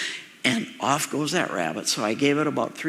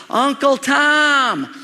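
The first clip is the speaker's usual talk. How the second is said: put-on high, slow drawn-out child's call; little girl calling; 11 semitones higher, 6 dB louder, much slower than usual. Little cousin calls from the house, high and drawn out. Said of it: "She yells to me".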